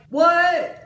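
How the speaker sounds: high, loud mock disbelief; playing the baffled Tamlin, hammed-up; loud incredulous shout at the dress; outraged Tamlin. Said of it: "Tamlin's like".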